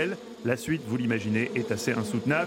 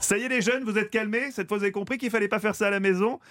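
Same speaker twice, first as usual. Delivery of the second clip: higher, teasing scold; mock scolding; 6 semitones higher than usual. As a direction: tell off the kids playfully. Scolding the young audience.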